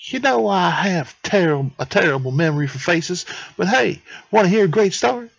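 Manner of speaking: high put-on would-be Italian accent; Anatoly. Anatoly offers Howie a story, high mock-Italian accent. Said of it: "that's gonna be the accent I give him".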